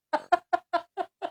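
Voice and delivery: a short, high real laugh; high chuckle. Real, not acted. Host laughing after joking they'll take back his pension. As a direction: laugh at your own quip.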